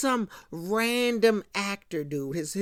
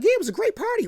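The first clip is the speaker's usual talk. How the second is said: breezy brush-off; 11 semitones higher, much faster than usual. Retold or imitated. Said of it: "Johnny just brushes him off".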